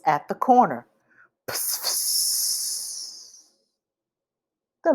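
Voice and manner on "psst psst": whispered hiss "psst, psst" like air brakes. A narrator's reading. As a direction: breathe out a soft hiss. Imitating bus doors.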